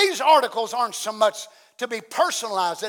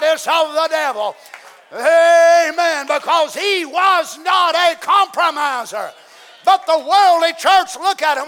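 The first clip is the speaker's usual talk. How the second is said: loud, high hellfire sermon shouting; fiery preaching; 5 semitones higher, 8 dB louder than usual. Acted. Pastor preaching against the worldly church, shouting. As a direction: thunder it at the congregation, building.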